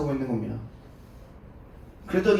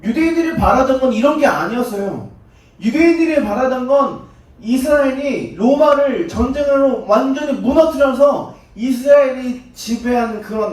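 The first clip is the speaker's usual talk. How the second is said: preaching; 7 semitones higher, 7 dB louder, slower than usual. Acted. Preacher drives home fulfilment.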